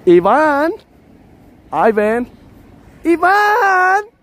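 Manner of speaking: high, loud calling out; repeated high street yell; screaming a name. Shown as a demonstration. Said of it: "I'm gonna scream Ivan".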